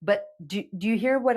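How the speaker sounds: ordinary talk, nothing marked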